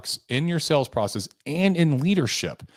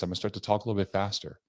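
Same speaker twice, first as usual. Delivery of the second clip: fast, excited delivery demo; talk faster; 2 semitones lower, 6 dB quieter, much faster than usual. Shown as a demonstration. Showing salespeople how he sounds when excited, fast. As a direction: speed up as if the news is good. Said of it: "then I'm gonna talk like this".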